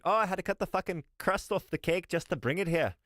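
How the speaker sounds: high, whiny mock of a proud baker; mock whiny castle-cake guy. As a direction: sneer it out like a smug show-off. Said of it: "and you're like".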